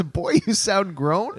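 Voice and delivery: drawn-out, questioning read-back of GROWN, rising; puzzled repeat. Retold or imitated. Co-host checking what Grammy says, puzzled questioning tone.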